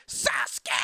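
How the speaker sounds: high, loud, drawn-out scream of a name; hammed-up battle cry; anime shout. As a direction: bellow it like an anime rival. Mimics anime rival shouting a name.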